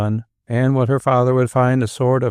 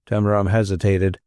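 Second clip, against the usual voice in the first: ordinary delivery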